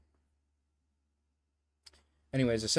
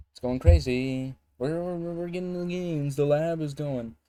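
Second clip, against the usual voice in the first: hyped intro bit; 6 semitones higher, 7 dB louder than usual. Pretend. Streamer opening his video with a bit, hammed-up hype. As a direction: stretch it out high and loud like a goofy intro.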